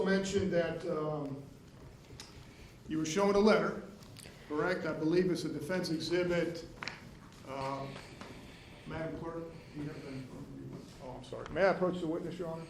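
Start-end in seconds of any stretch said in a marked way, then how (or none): none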